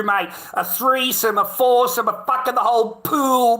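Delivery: high, loud, heated mockery; worked-up rant. Real, not acted. Mocking rant about girls' trip.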